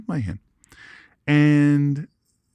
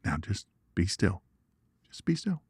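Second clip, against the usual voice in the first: softly, calm and hushed; soothing the snake after setting it down; soft hushed command; 3 semitones lower, 7 dB quieter than usual. Retold or imitated. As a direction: speak quietly to keep the snake still. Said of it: "I said".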